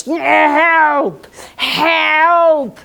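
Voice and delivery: loud, high, long-drawn tiger roar of "help"; growly cry for help. A narrator's reading. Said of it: "cried out in his growly kind of voice".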